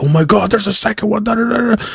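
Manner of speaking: high mock-excited chatter trailing into da-da-da; mock hyped fans. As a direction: imitate fans hyping it up, pitch way up. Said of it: "the hype behind it".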